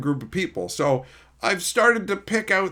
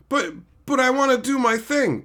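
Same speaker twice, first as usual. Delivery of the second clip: high, stammered put-on complaint; mock whiny protest; 9 semitones higher than usual. Imitating a player denied their turn again, mock whining. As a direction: protest like a thwarted child. Acted protest, repeated more insistently.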